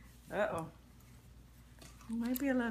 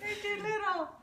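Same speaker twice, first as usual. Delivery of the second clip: laughing; 11 semitones higher than usual. Real, not acted. Overalls won't fit dog.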